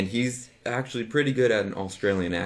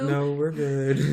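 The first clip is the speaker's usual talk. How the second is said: slow, higher, stretched-out answer; drawn-out reply; 6 semitones higher, much slower than usual. Retold or imitated. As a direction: sing out a long reassurance. Mock replay answering the drawling woman.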